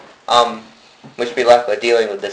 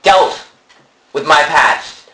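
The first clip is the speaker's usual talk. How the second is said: loud, high, heated shouting; angry shouting; 4 semitones higher, 5 dB louder, slower than usual. Acted. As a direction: shout back defensively. Heated mock quarrel.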